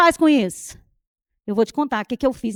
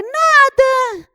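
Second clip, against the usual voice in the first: higher, pointedly sulky "Nada" with a long fall; 10 semitones higher, 6 dB louder than usual. Retold or imitated. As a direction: say "Nada" so the hurt shows openly. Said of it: "meu nada tava assim, escancarado".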